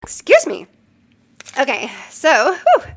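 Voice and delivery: right after a sneeze; loud sneeze recovery; sneezing. Real, not acted. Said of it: "I have to sneeze".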